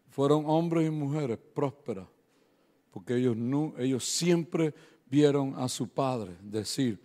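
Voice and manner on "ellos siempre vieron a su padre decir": ordinary talk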